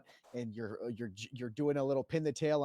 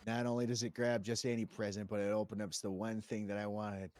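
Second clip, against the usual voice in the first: normal talking voice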